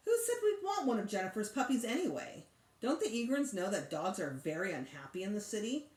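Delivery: ordinary delivery, nothing marked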